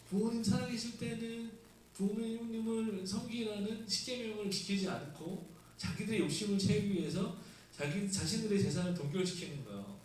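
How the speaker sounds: ordinary delivery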